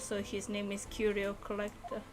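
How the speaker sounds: normal talking voice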